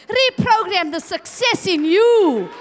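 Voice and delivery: rally the room at full voice; rising preaching shout. Real, not acted. Preacher rouses the church to overcome, shouting.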